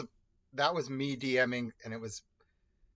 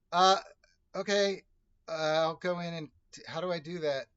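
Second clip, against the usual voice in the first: imitated hesitant newbie; 4 semitones higher, 5 dB louder than usual. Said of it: "my poor buddy was like".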